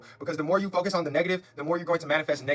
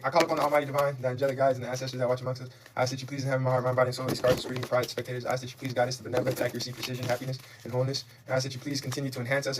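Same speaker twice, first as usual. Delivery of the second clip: low, hushed prayer calling on the divine; solemn invocation prayer; 6 semitones lower than usual. Acted. Reader blessing the cards before the live reading, hushed prayer.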